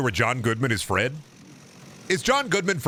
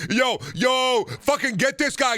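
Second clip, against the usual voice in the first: mock shout; 11 semitones higher than usual. Egging on a fight.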